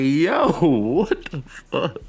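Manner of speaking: slow, high-pitched retold astonishment; amused shock. Viewer retelling his reaction to the crash, amused shock. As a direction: stretch it out in laughing disbelief.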